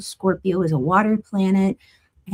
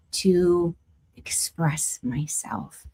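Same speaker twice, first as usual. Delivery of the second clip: whisper; 5 dB quieter, much slower than usual. Real, not acted.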